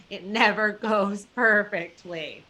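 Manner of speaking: slow it down and hit "never"; repeated for emphasis. Shown as a demonstration. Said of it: "I'm gonna repeat that".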